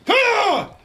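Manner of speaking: high sudden scared shout; startled yelp. Real, not acted. Gamer startled in the farmhouse, yelping.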